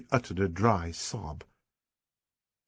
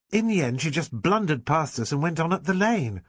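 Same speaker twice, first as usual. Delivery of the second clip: ordinary delivery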